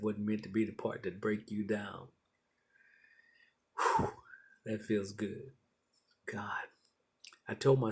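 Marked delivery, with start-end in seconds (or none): 3.8-4.1 heavy "whew" of relief; relieved exhale. Real, not acted. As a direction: blow out a long breath in relief. Relief after an emotional message.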